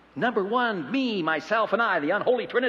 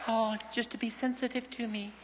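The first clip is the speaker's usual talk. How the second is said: much softer, gentle wife; hushed, tender reply from a wife; 4 semitones higher, 9 dB quieter than usual. Retold or imitated. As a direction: answer softly as the wife. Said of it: "You ask a woman".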